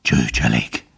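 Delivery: low, breathless scorn; panted contempt. A narrator's reading. Dying fox scorning the servants, low contemptuous wheeze. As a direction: gasp it out with boundless disdain. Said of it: "zihálta a róka mérhetetlen megvetéssel".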